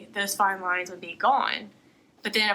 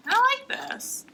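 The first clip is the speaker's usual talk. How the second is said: excited squeal. Real, not acted. Delighted at tiny product.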